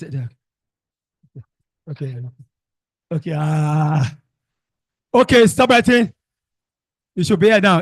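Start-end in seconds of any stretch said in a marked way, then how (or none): 5.1-7.8 loud, higher call to the class; raised teacher voice over the room. Real, not acted. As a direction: call the class to stop writing. Teacher calls class to order.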